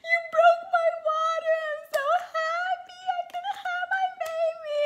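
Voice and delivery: mock happy sobbing, very high squeal; high, loud imitation of crying for joy. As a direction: wail it like overjoyed tears. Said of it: "and I'm crying".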